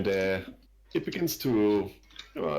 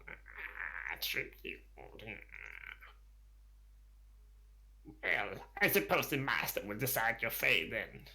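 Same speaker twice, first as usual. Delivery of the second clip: sly kobold; 7 dB quieter than usual. Acted. Second kobold answers guide.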